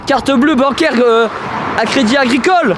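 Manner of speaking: high, excited bragging; hyped-up boast. Acted. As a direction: brag about the fans, pumped. Mock-hyped boast about subscribers.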